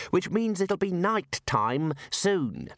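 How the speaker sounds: mildly insistent, drawn out; persistent. A narrator's reading. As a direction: press the point politely. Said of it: "Ponder persisted".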